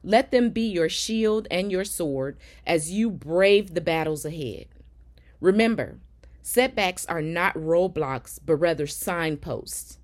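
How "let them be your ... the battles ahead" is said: normal talking voice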